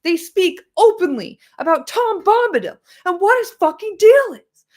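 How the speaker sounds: high, loud, exasperated outburst; exasperated rant. Real, not acted. Streamer ranting to chat about clueless fans, loud and exasperated. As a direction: let the frustration burst out loud and high.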